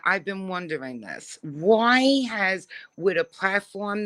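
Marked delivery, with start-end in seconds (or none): none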